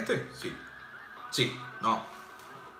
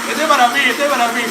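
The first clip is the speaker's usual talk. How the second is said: gleeful claim; 4 semitones higher, 7 dB louder, faster than usual. Real, not acted. Claims the drink for himself.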